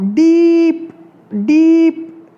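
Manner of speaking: डीप with the 'ee' held long and loud; long ई sound. Shown as a demonstration. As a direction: stretch the vowel in डीप: deeeep. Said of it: "इसमें बोल कर देखो आप". (डीप में लंबी, ज़ोरदार ई)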